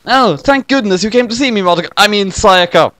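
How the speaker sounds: a hint of a happy boy; lightly voiced boy. Kyosuke greeting his visitor, lightly voiced boy.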